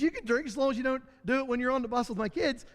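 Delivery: a hint of a cheerful mom brushing it off; mother's voice. Boys' mother answers him.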